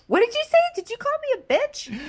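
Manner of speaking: very high put-on voice of offense; mock outrage. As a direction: imitate an offended girlfriend demanding answers. Acted lovers' quarrel.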